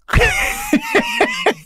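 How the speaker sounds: high squealing laugh at own joke. Real, not acted. Punchline about Brad's part.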